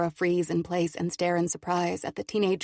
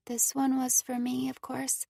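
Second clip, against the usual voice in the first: put-on young girl voice; 7 semitones higher than usual. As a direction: speak as a shy girl. Cora's girl voice.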